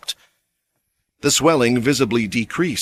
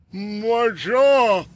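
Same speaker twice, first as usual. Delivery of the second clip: high pained cry; agonized; 12 semitones higher than usual. A narrator's reading. Injured bowman after the punch, agonized. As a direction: cry out in pain. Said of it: "came out slurred and agonized".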